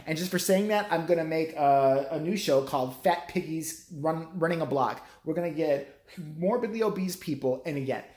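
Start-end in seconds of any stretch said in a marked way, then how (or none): none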